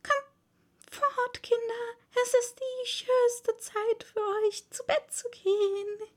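trembling chirp. A narrator's reading. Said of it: "piepte zitternd".

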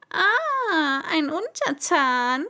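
high, loud, pleased cry of a king; thrilled to finally have a subject; delighted king. Acted. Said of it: "freute er sich".

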